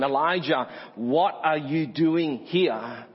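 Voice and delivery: weighty, drawn-out WHAT ARE YOU DOING HERE; slow, probing question. Acted. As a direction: ask it deliberately, each word stretched. Voices God's searching question to Elijah.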